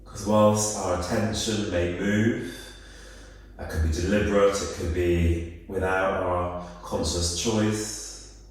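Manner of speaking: calm, unhurried body-scan voice with long pauses; guided meditation. Real, not acted. Yoga teacher guiding listeners through a body scan, calm guided meditation. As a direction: ease them into stillness, phrase by phrase.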